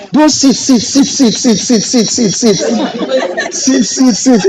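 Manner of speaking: high, rhythmic sit sit sit; mocking repetition. Real, not acted. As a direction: chant the endless waiting high. Mocking endless waiting.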